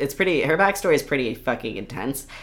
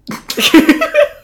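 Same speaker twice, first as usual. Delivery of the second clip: loud, very high-pitched laugh; burst of laughter; 12 dB louder than usual. Real, not acted. Laughing at the puberty joke.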